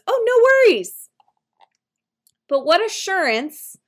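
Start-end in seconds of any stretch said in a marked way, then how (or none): 0.1-1.1 high, chirpy, louder "oh, no worries"; breezy go-to reply. Shown as a demonstration. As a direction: brush it off brightly, way up high. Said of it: "one of my personal favorites".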